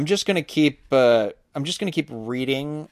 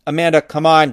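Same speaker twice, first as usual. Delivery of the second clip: louder, lightly whined plea; whining; 3 semitones higher, 7 dB louder than usual. A narrator's reading. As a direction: nag your sister with a mild whine. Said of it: "Josh whined".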